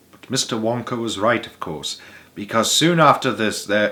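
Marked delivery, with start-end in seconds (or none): none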